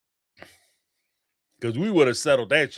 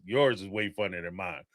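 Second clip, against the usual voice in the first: ordinary talk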